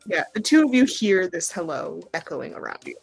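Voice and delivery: ordinary talk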